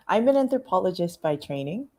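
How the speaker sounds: normal talking voice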